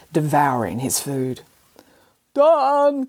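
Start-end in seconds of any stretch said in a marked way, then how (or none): 2.3-3.1 calling out. Acted. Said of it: "called Boris".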